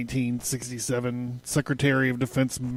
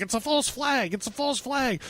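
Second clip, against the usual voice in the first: high, softer mocking squeal of alarm; mock conspiracy podcaster; 9 semitones higher, 5 dB quieter than usual. Host parroting conspiracy podcasts crying false flag, high-pitched mocking. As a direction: imitate excitable conspiracy theorists in a raised pitch.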